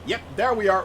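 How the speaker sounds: usual speaking voice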